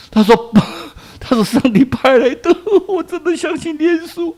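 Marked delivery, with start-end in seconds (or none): 1.2-4.3 amazed testimony. Acted. Mute child speaks out.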